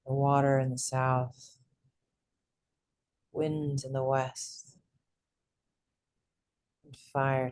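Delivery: soft, unhurried meditation voice with long pauses; guided meditation. Real, not acted. Host leads group through breath and elements, soft guided meditation. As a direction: guide the group into calm, hushed and even.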